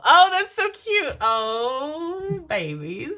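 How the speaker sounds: high, cooing delight; squealing oh, that's so cute; gushing. Real, not acted. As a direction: coo over something adorable. Gushing over cute couple detail.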